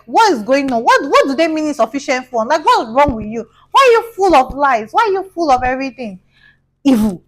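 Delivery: high, loud, indignant questioning; angry confrontation. Acted. Girlfriend calling him about the bounced cheque, acted angry outburst.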